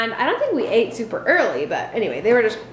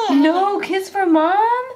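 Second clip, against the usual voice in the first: high, slow pretend heartbreak; mock hurt; 10 semitones higher, much slower than usual. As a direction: gasp like your heart is broken. Mock hurt at denied kiss.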